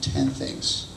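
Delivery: usual speaking voice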